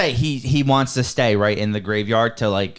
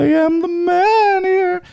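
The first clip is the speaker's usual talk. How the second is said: high, very slow, loud ghostly voice; 5 dB louder, slower than usual. Acted. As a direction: drag it out like a wailing ghost. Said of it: "That's my ghost voice".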